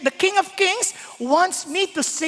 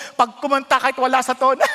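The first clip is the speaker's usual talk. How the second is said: usual speaking voice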